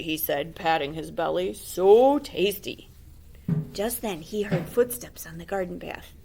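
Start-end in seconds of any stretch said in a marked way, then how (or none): 1.5-2.9 slow, loud, put-on wolf voice; greedy wolf. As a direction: drawl it out, licking lips. The wolf speaks.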